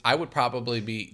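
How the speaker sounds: ordinary delivery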